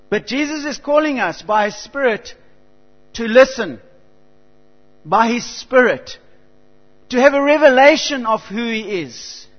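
fervent sermon build, loud and high; full-force preaching. Acted. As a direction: drive it home from the pulpit. Preaching climax.

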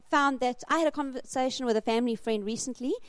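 normal talking voice

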